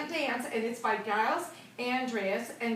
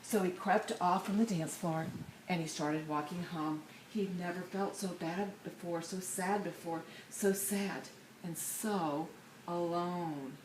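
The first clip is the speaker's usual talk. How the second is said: sad narration; 5 semitones lower, 6 dB quieter than usual. A narrator's reading. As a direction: let the sadness sink in. Sad passage read softly.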